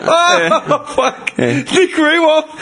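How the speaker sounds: high, drawn-out words through laughter; helpless laughing, slow and high; cracking up. Real, not acted. Cracking up with mates over a footballer joke, laughing.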